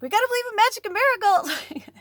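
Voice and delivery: a starry-eyed believer cheering herself on; giddy high pep talk. Retold or imitated. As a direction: squeal it up high and bright, hyped on magic. Self-talk acted as a pep voice.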